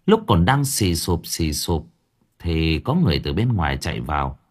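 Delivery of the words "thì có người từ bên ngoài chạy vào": plain reading voice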